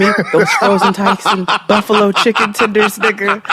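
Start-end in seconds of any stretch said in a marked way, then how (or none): none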